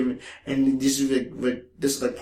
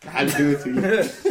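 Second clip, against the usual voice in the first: laughing through the line; giggling delivery; laughing quote. Retold or imitated.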